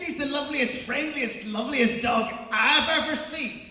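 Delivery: high, shouted superlatives; frantic gushing. Retold or imitated. Walker insisting to the dog owner, frantic loud gushing.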